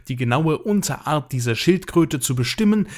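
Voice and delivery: ordinary talk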